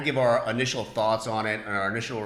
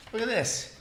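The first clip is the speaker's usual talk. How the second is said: ordinary delivery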